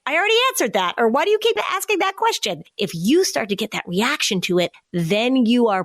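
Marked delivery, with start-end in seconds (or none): 0.1-2.6 high, exasperated and faster, losing patience; annoyed parent. Retold or imitated. Parent fed up with the child's repeated questions, exasperated. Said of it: "start feeling annoyed".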